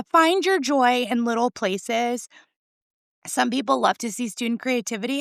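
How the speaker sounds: ordinary talk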